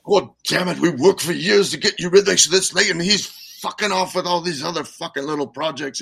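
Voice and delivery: mock fed-up rant down the aisle; muttering angrily. Annie grumbling about Dave on the bus, mock angry. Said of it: "she's muttering".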